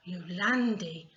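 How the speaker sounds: ordinary delivery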